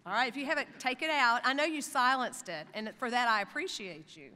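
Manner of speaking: calling out to crowd. Real, not acted. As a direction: call it out to the graduates so the back rows hear. Speaker rallies graduates with phones.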